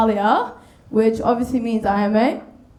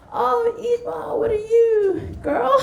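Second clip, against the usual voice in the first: teacher voice; 11 semitones higher, slower than usual. Retold or imitated. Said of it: "they're like".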